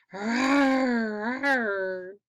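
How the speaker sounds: put-on a playful werewolf roar for the kids; wolf man roar.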